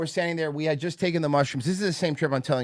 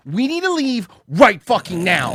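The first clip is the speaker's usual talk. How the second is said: urgent demand; 7 semitones higher, 8 dB louder, slower than usual. Retold or imitated. Buddy urgently demands leaving.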